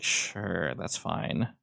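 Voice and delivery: soft, half-whispered answer; figure's hushed reply. Acted. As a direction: barely voice it, almost under breath. Figure's quiet gestured reply.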